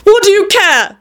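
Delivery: high-pitched angry outburst; furious shout. A narrator's reading. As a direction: throw it back, pitch leaping up. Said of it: "shouted Jamie again, furious".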